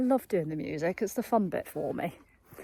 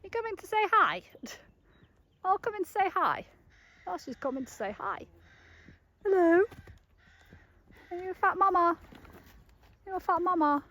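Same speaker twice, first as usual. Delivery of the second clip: much higher pet-talk voice; 9 semitones higher than usual. Real, not acted. Owner baby-talking the mare coming to say hi.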